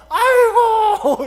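very high, loud, long-held cry; wailing outburst. Retold or imitated. Imitating dreamer's wrong cry coming out, loud wail. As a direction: let a hammed-up wail burst out. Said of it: "이 소리가 나오는 거예요".

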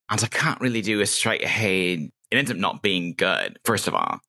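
normal talking voice

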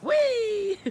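high loud squeal of joy; delighted whee. Real, not acted. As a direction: squeal with glee. Dogs zooming past.

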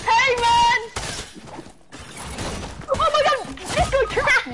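outraged shout. Real, not acted. Gamer yelling after getting trapped by a player. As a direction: yell in disbelief at being trapped.

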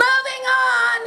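very high mock yell. Director cutting off the actress, high mock yell. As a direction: shout it over her protest. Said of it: "he yelled".

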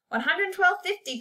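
high, sing-out taunt. A narrator's reading. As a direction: dangle the price at him tauntingly. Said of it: "taunted Jeremy".

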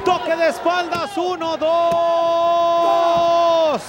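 long shouted pin count; excited count. Real, not acted.

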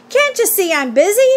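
put-on high, put-upon boy's protest; mild disgust. A narrator's reading. Avery to Missus Zuckerman while helping, mildly disgusted. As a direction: brush off a nagging grown-up, lightly acted. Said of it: "replied Avery in disgust".

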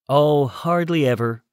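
breezy and offhand; lightly. A narrator's reading. Light, careless toss-off. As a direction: Addison brushing off the danger lightly. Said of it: "Addison said lightly".

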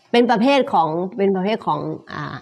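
ordinary talk, nothing marked

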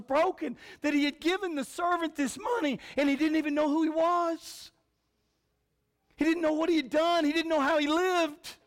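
high, loud, reproachful master confronting the servant; wounded master. Acted. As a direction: voice the heartbroken master at full force. Preacher voicing the angry master.

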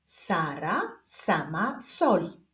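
slow word-by-word reading; measured beginner's reading with spaced words; reading demonstration. Shown as a demonstration. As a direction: read aloud slowly for learners to follow. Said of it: "zapraszam Was do odczytywania zdań".

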